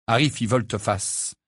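plain reading voice

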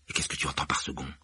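Harry asking Ron under his breath; whispered question. Acted. Harry asking quietly at dinner.

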